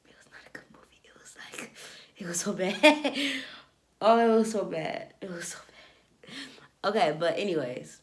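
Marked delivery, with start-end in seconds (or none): 0.0-1.7 whispered, confiding verdict; whispering. Real, not acted. 2.2-5.1 amused, laughing repeat. Real, not acted. 5.2-6.9 slow whispered repeat; whispering. Real, not acted.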